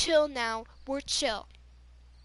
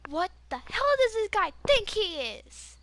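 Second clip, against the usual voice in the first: high, loud, indignant outburst; re-enacted exasperated fury; retold flipping out; 7 semitones higher, 6 dB louder than usual. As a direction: rage at someone who's absent. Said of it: "I flipped out".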